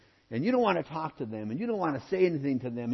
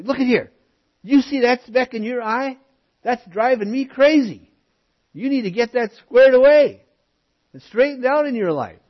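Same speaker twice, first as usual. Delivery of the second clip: much higher and louder, scolding the brother about his speck; nitpicking fellow churchgoer; 10 semitones higher, 11 dB louder than usual. Retold or imitated. Preacher mocking a fault-finder confronting a brother, high scolding voice. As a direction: play the fussy critic who can't let it go. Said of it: "and we want to say".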